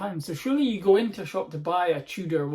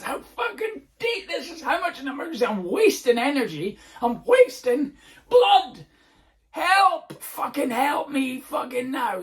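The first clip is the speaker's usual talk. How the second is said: much higher, loud hammed-up shrieks; mock frantic plea; 9 semitones higher, 7 dB louder than usual. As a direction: beg for help in faked panic. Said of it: "go mental".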